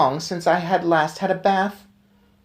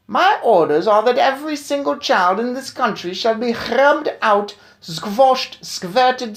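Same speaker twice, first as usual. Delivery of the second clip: put-on much higher, louder, accented commands; commanding witch tirade; 9 semitones higher, 4 dB louder than usual. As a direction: order the destruction of children. Witch issues her orders.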